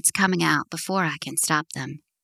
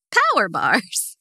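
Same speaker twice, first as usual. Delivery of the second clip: incredulous; 2 semitones higher, 5 dB louder, slower than usual. A narrator's reading. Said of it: "exclaimed in disbelief".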